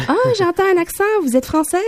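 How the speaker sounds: high-pitched, delighted-sounding mock surprise; nosy surprised stranger. Stranger in the street hearing an accent, high mock surprise. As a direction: pipe up high with exaggerated curiosity.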